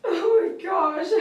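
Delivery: slow, high, crying laugh; overwhelmed, tears breaking through a laugh; real tearful laugh. Real, not acted. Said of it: "my tears don't, they don't even run down my face".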